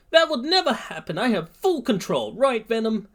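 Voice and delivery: put-on very high, agitated boy's yell. A narrator's reading. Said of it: "Midoriya yells".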